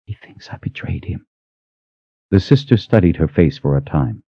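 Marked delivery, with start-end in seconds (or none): 0.0-1.3 whispered, grief-stricken repeat; barely audible, heartbroken whisper; tearful whisper. Acted. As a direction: whisper it through welling tears. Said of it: "tears welling up in her eyes".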